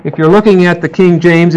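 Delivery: ordinary delivery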